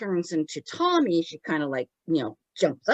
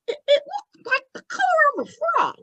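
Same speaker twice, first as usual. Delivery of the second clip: very high, stammering puppet voice; rushed squeaky childlike explanation; Tommy; much faster than usual. Acted. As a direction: falsetto little spirit, tripping over the start. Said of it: "poor Tommy goes".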